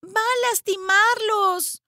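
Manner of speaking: high, drawn-out cry; slow, high girl's shout; alarmed protest. A narrator's reading. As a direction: plead loudly on the birds' behalf. Annie protests the stone throwing.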